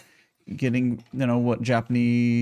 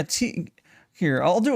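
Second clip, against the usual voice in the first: usual speaking voice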